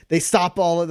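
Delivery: ordinary talk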